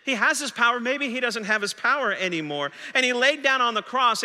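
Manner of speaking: usual speaking voice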